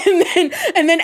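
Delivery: high burst of laughter; laughing. Real, not acted. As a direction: laugh through the words. Laughing at own joke.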